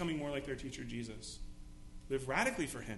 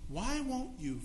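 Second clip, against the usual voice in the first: pleading lament; 9 semitones higher than usual. Retold or imitated. Believer pleading with Jesus.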